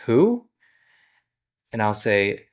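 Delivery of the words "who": confused who. Retold or imitated. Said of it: "they're gonna say".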